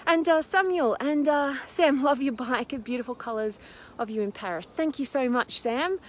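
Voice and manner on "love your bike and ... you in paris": ordinary talk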